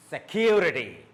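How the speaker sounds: security with CU punched, then falling; stress on CU. Shown as a demonstration. Teacher applying the up-then-down rule, stress on CU. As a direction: push up the CU in security. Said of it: "raise is stressed".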